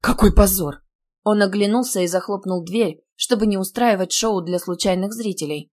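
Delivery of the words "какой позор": loud, outraged exclamation; scandalised outburst; indignant. A narrator's reading. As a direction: cry out in indignation. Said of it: "возмутился Аркадий Львович".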